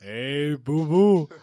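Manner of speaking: Yogi Bear impression. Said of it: "Can you do Fozzie the Bear?".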